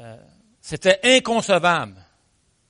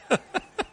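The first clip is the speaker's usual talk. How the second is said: high, soft chuckle; short laugh; 14 semitones higher, 7 dB quieter than usual. Real, not acted. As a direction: chuckle lightly at your own punchline. Laughs at own punchline. (petit rire aigu après la chute)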